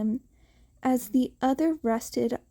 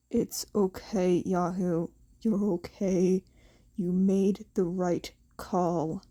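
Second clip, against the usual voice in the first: gentle comfort; 5 semitones lower than usual. Acted. Teacher comforting the sobbing teen, low and reassuring.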